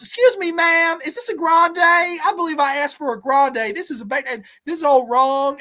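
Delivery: a put-out customer complaining to the window; shrill outrage over a wrong drink size; indignant Will impression. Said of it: "he leans over and goes".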